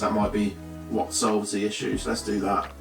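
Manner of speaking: normal talking voice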